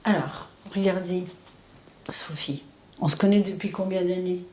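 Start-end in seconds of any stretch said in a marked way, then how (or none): 2.1-2.6 hushed whisper. Real, not acted. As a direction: say her name in a whisper. Doctor whispers her name.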